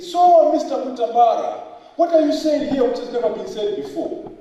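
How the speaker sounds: high, loud mimicry of a viva examiner; challenging examiner. Examiners questioning his thesis at the viva, challenging. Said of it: "emphasis on Mister".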